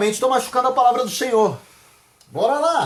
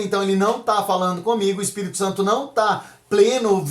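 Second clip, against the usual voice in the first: ordinary delivery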